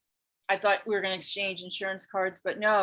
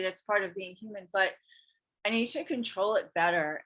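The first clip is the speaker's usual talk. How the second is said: ordinary talk, nothing marked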